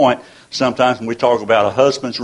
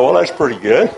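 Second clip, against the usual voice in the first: laughing; 2 semitones higher, 3 dB louder than usual. Real, not acted. Laughing at grandson's joke.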